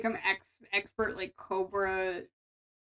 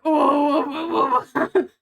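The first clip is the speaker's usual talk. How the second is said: mock alarm; 4 semitones higher, 10 dB louder, much slower than usual. Shocked by sex toy requests.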